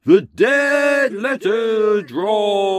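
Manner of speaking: slow, booming segment title; announcer voice. Acted. Host announcing his segment, slow grand announcer voice. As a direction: announce it like a game show.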